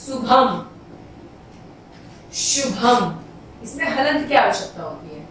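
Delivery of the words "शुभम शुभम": ordinary talk, nothing marked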